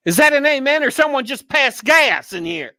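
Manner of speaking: high-pitched pastor's holler, hammed up; mock preacher scream. Retelling a funny pastor's outburst in church, mock scream. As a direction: imitate a screaming pastor from the pulpit. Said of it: "he, he'd scream".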